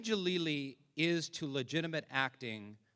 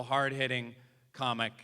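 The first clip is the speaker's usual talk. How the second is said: ordinary delivery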